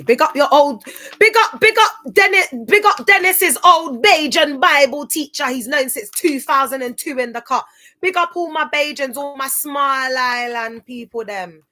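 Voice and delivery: loud, high Bajan shout-out; big up the Bajans in a Barbados accent. Shouting out Bajan viewers in a Barbados accent. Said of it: "That's how they speak there in Bar-, Barbados".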